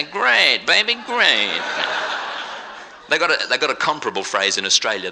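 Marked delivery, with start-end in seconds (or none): 0.0-1.6 imitating Americans. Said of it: "and they say".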